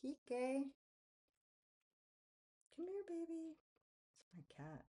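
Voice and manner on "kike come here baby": loud, high call to a pet; calling the cat. Real, not acted. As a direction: call the cat over from across the room.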